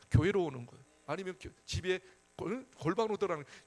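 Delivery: ordinary talk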